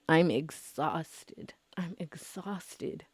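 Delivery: slow, breathy-free whispered sigh of a line; exhausted whisper. Real, not acted. Vlogger admitting to viewers she is worn out, whispered slowly. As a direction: let it drain out of you in a whisper. Drained admission, repeated.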